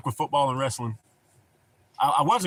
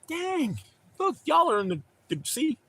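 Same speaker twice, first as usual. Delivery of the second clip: pitched way up in sudden surprise; surprised exclaim. Real, not acted. Streamer sees the sea on chat's photo, amazed and high-pitched. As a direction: gasp out the words, delighted.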